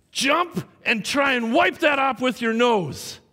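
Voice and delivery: high, slow, forceful mock yell; screaming teacher. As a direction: act out a furious teacher's humiliating order. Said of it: "he's just screaming at me".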